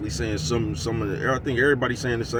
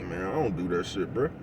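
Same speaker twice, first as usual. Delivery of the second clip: normal talking voice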